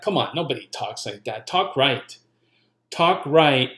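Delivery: usual speaking voice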